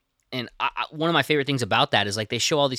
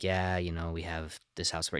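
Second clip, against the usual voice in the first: low, quiet, unruffled radio report; super calm; 6 semitones lower, 9 dB quieter than usual. Retold or imitated. Imitating the first officer on scene radioing in a fire, super calm. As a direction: keep it calm and matter-of-fact, soft and low. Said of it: "he's super calm and he just sounds like this".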